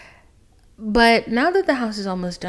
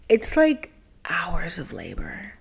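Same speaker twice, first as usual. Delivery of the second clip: slow whisper; 2 semitones lower, slower than usual. Real, not acted. Labor joke about confessing.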